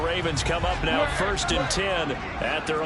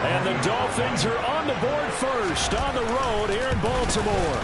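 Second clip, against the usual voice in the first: touchdown call; 5 semitones higher than usual. Real, not acted. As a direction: lift the voice for the score.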